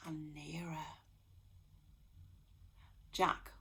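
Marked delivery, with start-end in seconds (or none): 0.1-0.9 whisper. A narrator's reading. Narrator whispering as the green eyes creep nearer. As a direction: breathe it out in a hush.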